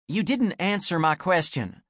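insistent. Acted. Anne presses Gretchen.

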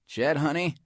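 high, sugary cafeteria lady calling him honey; a big tough lady going sweet and high; Missus Bagoong voice. Acted. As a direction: cafeteria lady's high fond greeting.

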